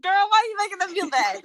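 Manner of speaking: high, teasing scold; mock scolding. Friend teasing player for upsetting kids, high mock scold. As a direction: playfully scold a friend at the table.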